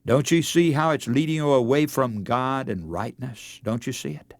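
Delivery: normal talking voice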